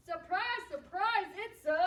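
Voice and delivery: high, loud surprise announcement; excited build-up. Acted. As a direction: tease a big reveal for children. Builds up to a reveal.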